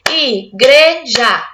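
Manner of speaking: syllables laid out slowly; slow spelled-out word. Shown as a demonstration. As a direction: stretch Igreja very slowly, syllable by syllable. Teacher sounds out word for child.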